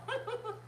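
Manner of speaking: high, soft little giggle. Real, not acted. Host amused by her Tabitha slip, high giggle. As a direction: giggle quietly at yourself.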